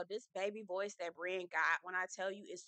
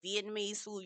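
ordinary talk, nothing marked

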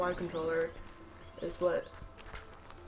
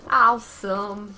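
loud, drawn-out Awesome; excited shout; 12 dB louder, much slower than usual. Real, not acted. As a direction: belt it out slowly. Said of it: "repeated louder".

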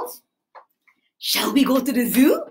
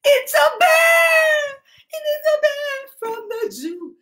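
excited reveal; 11 semitones higher than usual. Pretend. Revealing toy bear to children.

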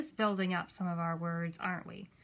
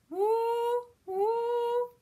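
high, long siren wail; imitated siren whoop; fire engine siren; 12 semitones higher, 4 dB louder than usual. Shown as a demonstration. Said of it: "The fire engine's on the way".

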